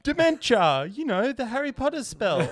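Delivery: put-on high, loud kid voice explaining the spell; child insisting. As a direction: play the daughter who is sure she's right. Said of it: "She goes".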